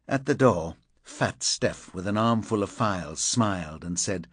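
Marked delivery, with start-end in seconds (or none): none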